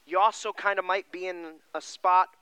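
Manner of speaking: ordinary talk, nothing marked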